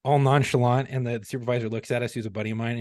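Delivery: ordinary talk, nothing marked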